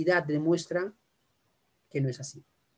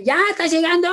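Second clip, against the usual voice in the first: jefe imitado; 11 semitones higher, 7 dB louder than usual. Retold or imitated. Imitating the boss's pressure.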